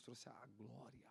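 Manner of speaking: drawn out, slow and softening; slow hushed glory. Acted. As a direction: let "trouxe a glória" sink slowly. Sermon climax fading on glory.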